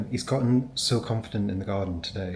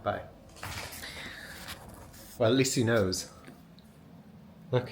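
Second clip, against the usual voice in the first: ordinary talk, nothing marked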